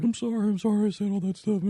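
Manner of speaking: high, whimpering parody of backing down; put-on squeaky grovel, softening at the end; mock meek apology. Mimicking a TV guest backing down to the host, mock meek apology. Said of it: "goes in with his tail between his legs".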